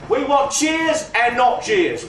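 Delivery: slow, stressed cheers and jeers; slow slogan. Acted. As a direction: spell out the slogan slowly. Slogan to audience.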